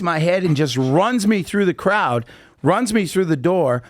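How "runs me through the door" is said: usual speaking voice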